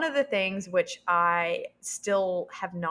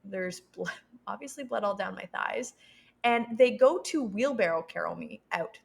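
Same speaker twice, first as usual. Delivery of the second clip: usual speaking voice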